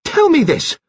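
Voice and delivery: high, urgent outcry; cried out. A narrator's reading. Nurse Kettle crying out at Kitty, high and urgent. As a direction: cry it out sharply. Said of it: "Nurse Kettle cried out".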